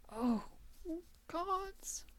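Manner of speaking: high breathy cry of shock; a choked gasp of horror; horrified cry. Acted. Gordon witnessing the murder reveal.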